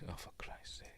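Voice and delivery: whispered under the breath in exasperation; a voiceless exasperated aside. Real, not acted. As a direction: mutter it to yourself, fed up. Muttered aside at the screen.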